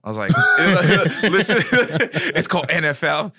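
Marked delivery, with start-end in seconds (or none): 0.3-3.3 laughing through the quote; can't stop laughing while telling it; real laughter breaking the words. Real, not acted. Laughing retelling gang invitation.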